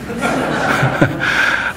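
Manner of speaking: a soft breathy chuckle at his own punchline; amused laugh. Real, not acted. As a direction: laugh quietly at the joke. Laughs at own dialect joke. (자기 농담에 조용히 웃음)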